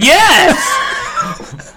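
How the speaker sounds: squeaky high exclaimed "Yes!"; startled yes. Retold or imitated. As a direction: blurt it out in shock. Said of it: "And I was like".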